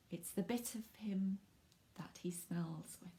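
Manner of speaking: soft conspiratorial whisper; whispered clue. Real, not acted. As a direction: hush and tease the answer. Giving a clue to children.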